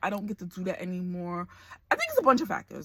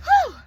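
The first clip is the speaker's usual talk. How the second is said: shaky exhale; 13 dB louder than usual. Real, not acted. Fighting tears, exhales sharply.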